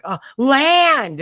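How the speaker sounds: high, loud cry of relief at spotting land; excited shout. Retold or imitated. Castaway family yelling on first seeing land, loud. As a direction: yell it out like a castaway.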